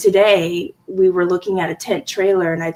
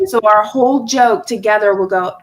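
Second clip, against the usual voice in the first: ordinary delivery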